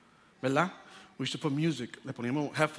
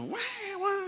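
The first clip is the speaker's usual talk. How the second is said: llanto agudo y bajito de recién nacido; llanto de bebé imitado; 5 dB quieter than usual. Retold or imitated. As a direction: imita al nene llorando. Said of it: "el nene estaba llorando". (berrido de bebé, imitado)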